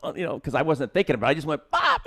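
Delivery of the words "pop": very high-pitched sudden pop; imitated pop. Actor retells beads flying everywhere, high imitated pop. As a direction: squeak out a sudden popping sound. Acts out the beads snapping again.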